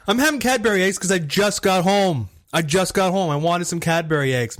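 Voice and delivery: annoyed. Real, not acted. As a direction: snap back defensively. Defensive retort in argument.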